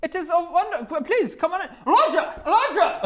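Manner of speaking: very high, loud welcome; excited young Goliath woman. Acted. Young woman calls Roger to see visitor, excited high shout. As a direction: welcome him in, then call upstairs, high and loud.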